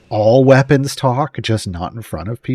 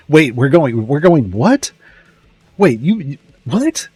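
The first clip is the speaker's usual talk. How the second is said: Sortle confused; 3 semitones higher, 4 dB louder than usual. Retold or imitated. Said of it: "is all like".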